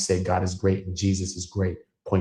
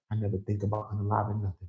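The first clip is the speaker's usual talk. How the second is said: ordinary delivery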